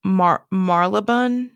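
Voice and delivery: Marylebone as MAR-luh-bun, slowly; own pronunciation. Shown as a demonstration. Trying out a London place name, Marylebone as MAR-luh-bun. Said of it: "to me, I would say".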